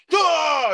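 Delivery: sudden high, loud "ah"; mock roar. Host acting out a character bursting out of the ground, mock roar.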